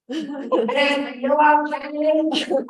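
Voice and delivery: high-pitched meddling woman voice; meddling lady. Retold or imitated. Lady at the next table butts into a date, meddling, high-pitched. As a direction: butt in as a high-voiced stranger. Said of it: "this lady next to us decides to get involved".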